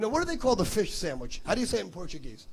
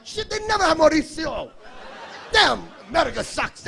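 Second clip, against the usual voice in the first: mock outrage; 7 semitones higher, 5 dB louder than usual. Mock outrage at gift shop.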